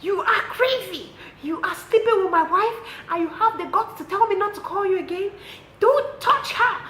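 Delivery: high, loud yelling; angry shout. Retold or imitated. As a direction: yell the insults furiously. Angry caller insulting on speaker.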